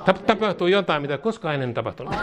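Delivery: normal talking voice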